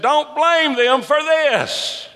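high, drawn-out plea; crying out. Acted. Jesus and Stephen pleading for their killers, crying out. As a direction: cry it out slowly, high above your speech. Acting out dying men's plea.

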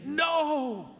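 long, high-pitched acted "no" of grief; protesting cry. Preacher acting out grief's protest to the congregation, crying out. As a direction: cry out in mock anguished protest, pitch leaping up. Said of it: "an expression of protest".

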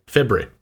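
February cut down to FEB-ree; shortened pronunciation. Shown as a demonstration. Panellist shows his Australian way, February as FEB-ree. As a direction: squeeze February into two syllables. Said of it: "And I say".

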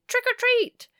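high, loud cheerful cry; hearty greeting. Acted. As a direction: call it out with glee. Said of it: "a hearty".

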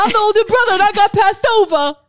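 hammed-up jealous older brother; mock Fredo complaint.

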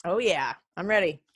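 ordinary delivery

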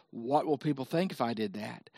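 low, very soft inner worry; hushed acted second thoughts; self-doubt. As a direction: sink to a small private voice. Voicing a believer's worried thought.

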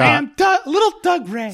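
high squeaky voice; helium voice. Pretend. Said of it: "He said".

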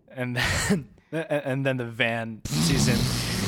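ordinary talk

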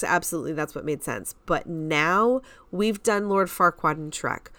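usual speaking voice

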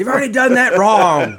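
high, loud teasing jab at a friend; mock scolding voice. Mocking a friend at attic.

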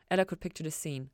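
plain reading voice

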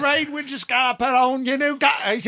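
high, lightly posh gentleman voice; mock posh English accent. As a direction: ham up a toff inviting workers. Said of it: "we're doing all these shitty accents".

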